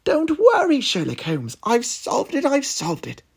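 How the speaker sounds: high, loud triumphant call; excited shout. Acted. Watson shouts to Sherlock she solved it, high. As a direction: burst out with the answer. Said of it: "Watson shouted".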